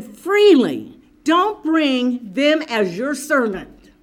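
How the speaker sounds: loud, emphatic preaching; fervent preaching. Acted. Preacher telling the church to give freely, emphatic preaching. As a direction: proclaim it with force.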